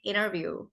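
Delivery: interview as "innerview", T dropped; American rolled T. Shown as a demonstration. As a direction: let the T in interview melt into the N. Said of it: "Americans roll their T's also".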